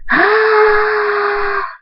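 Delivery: long, high-pitched scream. A narrator's reading. Thunderstorm shoots awake in the healing chamber, long high scream. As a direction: scream one sustained, very high note. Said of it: "Thunderstorm screams".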